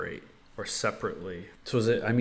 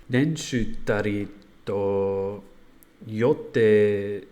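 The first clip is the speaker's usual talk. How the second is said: slow careful learner Japanese; halting Japanese from an English speaker; japanese learner accent; 6 dB louder, much slower than usual. Real, not acted. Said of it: "I'll try".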